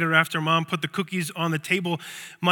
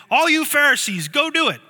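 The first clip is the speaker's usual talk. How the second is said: mock command; 9 semitones higher, 6 dB louder than usual.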